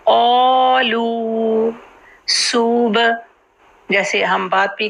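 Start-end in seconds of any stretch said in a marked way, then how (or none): 0.1-1.7 क़ालू with a heavy क़ and the long ā held two counts, slow; क़ालू recited slowly, heavy qaaf, stretched aa; क़ालू slow tajweed with heavy qaaf; Qur'anic recitation. Shown as a demonstration. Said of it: "टू हरकत का same time".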